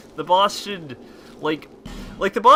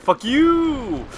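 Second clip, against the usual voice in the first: very high, loud, stretched-out YOU; long, extended curse; 13 semitones higher, 6 dB louder than usual. Shown as a demonstration. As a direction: wail it out, drawing YOU way up high. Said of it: "do that really long, extended".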